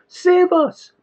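high, loud desperate plea. A narrator's reading. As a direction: beg the sky to save you. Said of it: "prayed the sailors".

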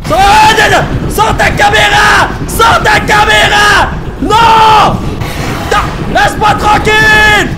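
high, loud, slow panicked shouts; scared horror game streamer. Real, not acted. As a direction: scream at the monster to leave. Monster grabs player. (streamer terrifié qui hurle)

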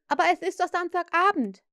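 Slightly begs Wendy to dance; pleading. A narrator's reading. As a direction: coax her into it eagerly. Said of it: "beschwor Slightly sie".

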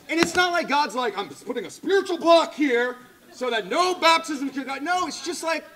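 loud, high-pitched sermon delivery; full-force preaching. Real, not acted. Preacher challenging the church about not sharing faith, full-force preaching. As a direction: preach it out hard to the whole room.

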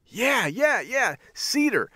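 very high, delighted retold yeah; high-pitched, drawn-out enthusiasm; excited agreement. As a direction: act out gleeful agreement. Delighted agreement on baby's name.

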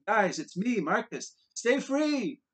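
loud, high rallying cry; dragged past the cells, shouting to friends; defiant shout. A narrator's reading. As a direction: yell it so the whole block hears.

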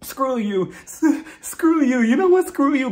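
high-pitched, hammed-up angry outburst; mock screaming. Host imitates Jacqueline cursing Mia, mock screaming. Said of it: "she starts crying and screaming".

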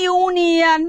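ইউনিয়ন stretched slowly from a long "you"; long U vowel. Shown as a demonstration. Teacher gives another example, ইউনিয়ন with long U drawn out. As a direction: hold the opening "you" of ইউনিয়ন. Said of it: "সেটা long vowel উচ্চারণ হবে".

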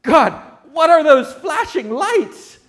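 high, loud panicked cry; mock panic at fireflies; freaked-out quote. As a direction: shriek it like he's scared of the lights. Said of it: "he starts to freak out".

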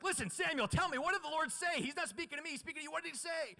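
very high, hammed-up urgent pleading; overacted, high-pitched demanding voice; Eli coaxing Samuel. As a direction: press the boy excitedly for the answer. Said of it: "Eli's like".

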